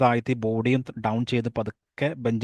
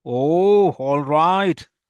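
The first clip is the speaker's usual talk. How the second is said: louder, higher "oh, all right" in defeat; conceding; 4 semitones higher, 7 dB louder, much slower than usual. Acted. Ravi surrounded by vendors, loud higher reluctant giving in. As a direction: give in with a loud sigh-like "oh".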